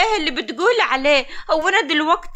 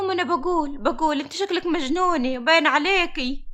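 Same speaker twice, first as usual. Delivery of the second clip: normal talking voice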